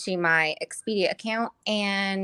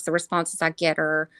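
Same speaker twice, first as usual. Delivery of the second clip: ordinary talk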